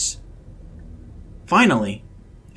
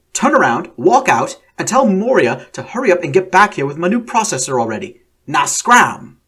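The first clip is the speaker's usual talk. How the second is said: louder, bossy Australian man shooing her off; loud Australian orders; 5 semitones higher, 6 dB louder than usual. A narrator's reading. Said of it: "Then the voice spoke up".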